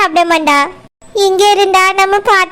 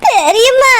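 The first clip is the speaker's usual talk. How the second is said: higher, tearful call to an aunt; crying; 4 semitones higher, slower than usual. Acted. Girl at her aunt's feet appealing to her, crying. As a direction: cry out the word pleadingly. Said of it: "Jennifer எதுக்கு இப்படி அழறா?".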